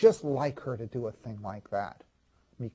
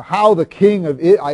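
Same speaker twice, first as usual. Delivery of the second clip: mock-sarcastic wife, suddenly much louder; 3 semitones higher, 10 dB louder than usual. Wife mocks the king as he comes home, loud sarcasm. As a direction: imitate her sarcasm loudly, then break off. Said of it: "I could just hear her sarcastic tone of voice".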